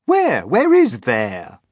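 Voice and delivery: loud, insistent, high-pitched questions; clamouring high, then drawn out; crowd asking. Acted. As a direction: demand an answer at full volume. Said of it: "they asked".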